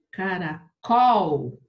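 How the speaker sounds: "Caracol" very slow, COL loud and long; stress on COL. Shown as a demonstration. Teacher showing the accent is wrong, stressed on COL. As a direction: punch and stretch the final COL of "Caracol". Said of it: "Tem acento no col".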